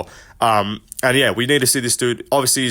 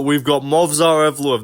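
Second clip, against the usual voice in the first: normal talking voice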